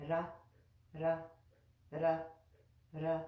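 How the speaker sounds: "Ra" with tongue just behind the upper teeth; tongue-tip ra. Shown as a demonstration. Teacher drilling the r sound. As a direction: tap "Ra" lightly, near a rolled r. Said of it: "practice that with me".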